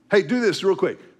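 ordinary talk

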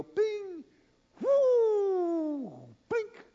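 high, long whooshing cast sound; mock smooth cast. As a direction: sing out a graceful, easy cast. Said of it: "And he'd go".

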